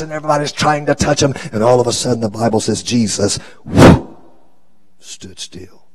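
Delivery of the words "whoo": loud voiceless whoosh. Acted. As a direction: blow a short, sharp whoosh. Imitates crowd suddenly halting.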